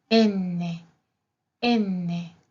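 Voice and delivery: "N" as enne, holding the double n; double consonant. Shown as a demonstration. Teacher demonstrating Italian N twice, deliberate double-consonant demo. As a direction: say "N" in two beats, en-ne. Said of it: "también se pronuncia con doble N".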